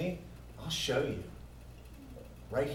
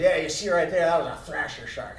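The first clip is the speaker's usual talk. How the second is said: Jaws sailor; 3 semitones higher, 6 dB louder than usual. Retold or imitated. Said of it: "one guy lifts up and he goes".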